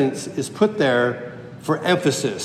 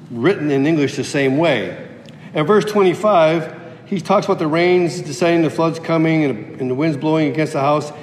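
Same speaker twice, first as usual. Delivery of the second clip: ordinary talk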